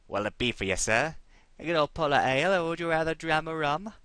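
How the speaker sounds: flirty Cockney tavern wench serving ale; a barmaid in a Cockney accent, flirting with a customer. Acted. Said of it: "Give her a nice little Cockney accent".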